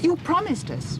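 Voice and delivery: very high desperate cry; anguished plea; pleading protest. Acted. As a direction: hold him to his word. Dorothy protests to king.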